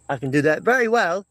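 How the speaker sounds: put-on English accent showing off. Shown as a demonstration. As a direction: demonstrate your English impersonation. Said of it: "English accent, uh, impersonation".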